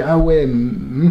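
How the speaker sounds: slow, low "ah ouais" into a hum; slow mock-dismayed "oh yeah" with a humming laugh; imitated horrible laugh. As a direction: imitate an unimpressed, drawn-out reaction. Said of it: "j'ai fait ce rire horrible".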